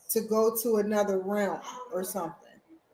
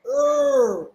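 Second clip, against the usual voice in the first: effortful grunt forcing the can open; loud pushing grunt; straining grunt; 4 semitones higher, 6 dB louder than usual. Shown as a demonstration. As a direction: strain like prying a lid. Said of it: "kinda like".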